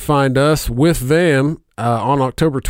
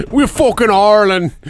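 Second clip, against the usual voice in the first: higher, louder hammed-up Irish accent; mock Irish accent; 10 semitones higher, 4 dB louder than usual. Joking about McGregor, a clumsy Irish accent. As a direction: ham up a bad Irish accent for laughs. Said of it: "get a fucking Irish accent".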